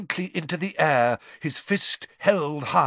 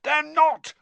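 high, forceful denial; heated high-pitched shout; roar; 14 semitones higher, 4 dB louder than usual. A narrator's reading. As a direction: roar back in disagreement. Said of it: "roared Ron".

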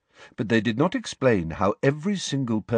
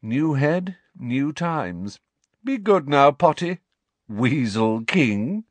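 higher, gleeful mocking taunt; lazy arrogant nobleman; 6 semitones higher, slower than usual. A narrator's reading. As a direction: toss it off smugly as you leave. Malfoy's parting taunts.

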